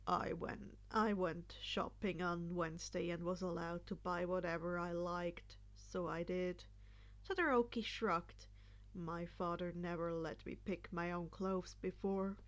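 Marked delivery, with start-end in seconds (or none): 0.1-6.6 put-on lower, subdued young man, answering matter-of-factly. Todoroki's reserved low voice. 8.9-12.4 put-on lower, subdued young man, answering matter-of-factly. Todoroki's reserved low voice.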